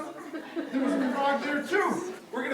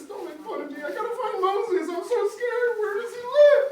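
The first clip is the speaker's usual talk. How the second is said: higher and louder, near tears; scared whine; 8 semitones higher, 9 dB louder than usual. Acted. Pharaoh begs the kids not to mock him, scared whine. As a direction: plead like a frightened child.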